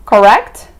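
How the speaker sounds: "correct" rising as a question; questioning tag. Shown as a demonstration. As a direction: swing "correct" upward at the end. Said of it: "Sempre termina com interrogação".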